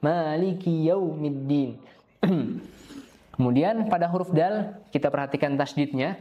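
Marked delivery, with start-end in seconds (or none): 0.0-1.8 "Maliki yaumiddin" slow with "ki" kept short; slow, short-ki recitation. Shown as a demonstration. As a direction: run "ki" straight into "yau". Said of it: "dibacanya maliki yau langsung".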